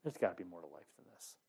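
low, soft, musing to himself; quiet inner thought. Retold or imitated. Dishwasher at nineteen, quietly wondering about life. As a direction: murmur it like a private realisation. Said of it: "just going".

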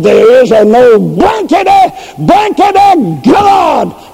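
shouting defiance at God through gritted teeth, dragging out the last words; retold furious outburst. A scoffer shouting at the preacher, furious. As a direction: shout it like a man defying heaven. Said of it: "gritted my teeth".

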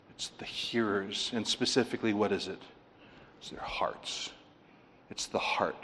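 breathy near-whisper, words leaning in close; hushed whisper. Real, not acted. Preacher whispering the answer to the congregation, hushed. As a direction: drop to a whisper for the key point. Preacher drops voice for the key point.

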